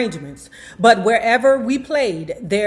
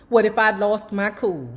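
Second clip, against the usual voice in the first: ordinary delivery, nothing marked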